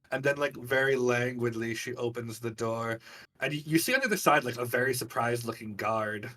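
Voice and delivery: normal talking voice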